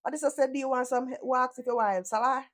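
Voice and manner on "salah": usual speaking voice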